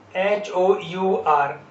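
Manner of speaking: slow letter-by-letter spelling; teacher spelling it slowly; spelled out slowly. Shown as a demonstration. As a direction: spell each letter out deliberately. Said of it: "spelling letters slowly".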